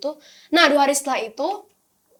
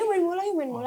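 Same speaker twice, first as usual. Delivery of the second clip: put-on children inviting; 6 semitones higher, faster than usual. Voices children inviting to play.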